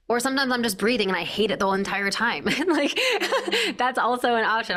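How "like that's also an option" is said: giggling confession; laughing. Real, not acted. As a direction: laugh through it, pitched up. Laughs at own admission.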